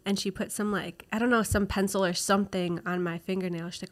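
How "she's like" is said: usual speaking voice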